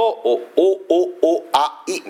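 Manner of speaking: お、お、お、あ、い as separate, evenly spaced vowels; vowels only. Shown as a demonstration. Preacher showing the congregation a word's vowels, vowel by vowel. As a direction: sound out each vowel alone, お、お、お、あ、い. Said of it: "母音だけ取りますと".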